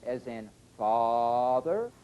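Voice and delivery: father with a long open ah; slow ah in father. Shown as a demonstration. Teacher slowly modelling the ah vowel. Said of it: "including ah".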